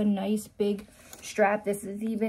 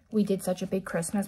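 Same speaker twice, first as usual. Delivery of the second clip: ordinary delivery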